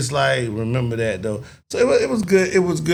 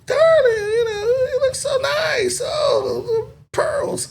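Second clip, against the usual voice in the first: much higher, hammed-up gushing "darling" voice; flamboyant mock voice; slower than usual. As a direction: gush over the pearls like a camp socialite. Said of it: "He was trying to play".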